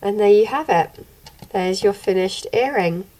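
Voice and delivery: normal talking voice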